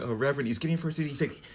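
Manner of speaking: ordinary talk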